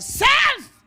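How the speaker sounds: sudden high, loud yell; explosive one-word preacher's cry; shouted call. Acted. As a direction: shout it like hailing someone. Calls to self, suddenly shouting.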